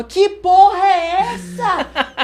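imitated outraged yelling. Corner team scolding their fighter mid-fight, imitated outraged yelling. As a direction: mimic a cornerman screaming in exasperation. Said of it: "o carioca gritando".

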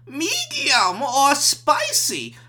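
put-on very drawn-out, high Chinese accent; Chinese waiter. Chinese guy repeating the spice question, exaggerated Chinese accent. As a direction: stretch every word in an exaggerated Chinese accent. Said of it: "the Chinese guy was like".

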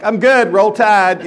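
embarrassed cheer. Retold or imitated. Fallen man to the crowd, a loud slow cheer. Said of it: "And I'm like".

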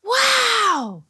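very high, long-drawn "wow"; excited. A narrator's reading. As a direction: squeal it in childlike wonder. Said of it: "Billy was so excited".

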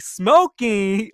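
piercing imitated mom outburst; loud mock yell. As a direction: blast it like a disbelieving mother.

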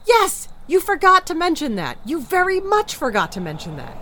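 high, loud, incredulous outburst; exasperated shout. Acted. Wife to husband who forgot to say he was barefoot, exasperated shout. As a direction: yell it back in disbelief.

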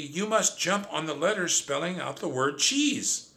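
high, excited instruction; gleeful. A narrator's reading. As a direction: urge them on in high-pitched glee. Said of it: "I shrieked with glee".

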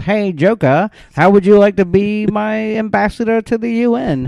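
high, hammy cartoon villain voice; mustache twirling villain. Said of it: "Snidely Whiplash, like, twirling his mustache".

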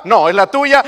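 loud, blaming retort on tuya; mock quarrel. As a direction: throw the blame back hard. Acted couple's quarrel.

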